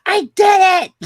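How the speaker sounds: high, loud triumphant shout; excited shout. Retold or imitated. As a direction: yell it in triumph. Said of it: "I'm like".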